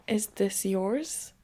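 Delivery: a lightly put-on, slow thick Russian accent. Russian stranger returning the cat, in a mild Russian accent. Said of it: "In a thick Russian accent, he asks".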